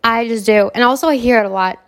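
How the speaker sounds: ordinary talk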